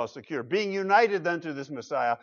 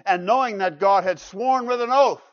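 loud, raised preacher's voice; emphatic preaching; 6 semitones higher, 8 dB louder than usual. Real, not acted. Preacher expounding David's oath from God, emphatic preaching. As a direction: proclaim it to the congregation.

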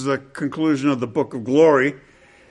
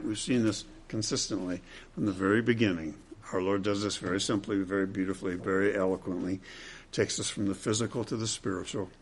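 hushed aside; 7 semitones lower, 12 dB quieter, faster than usual. Acted. Preacher's soft aside.

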